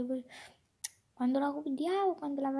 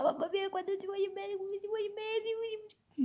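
gushing affection; 5 semitones higher, faster than usual. Pretend. Telling someone how much they are loved, high fast gushing. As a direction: pour out love in a high rush.

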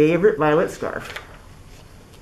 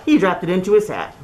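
usual speaking voice

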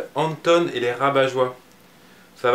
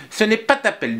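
put-on bossy girl; 9 semitones higher than usual. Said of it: "dit Nina".

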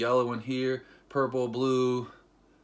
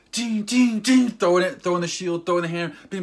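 loud, high imitated clangs; animated, raised-voice action noises; excited battle play-by-play; 7 semitones higher, 8 dB louder than usual. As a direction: punch out each blow like comic sound effects. Acts out rapid hero attack.

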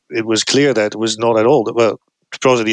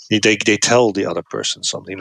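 normal talking voice

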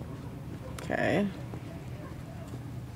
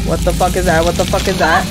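startled; 5 dB louder than usual. Real, not acted. Startled by bugs in game.

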